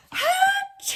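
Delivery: explosive pretend sneeze; big sneeze. A narrator's reading. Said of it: "makes the bear".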